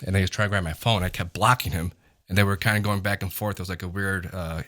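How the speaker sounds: usual speaking voice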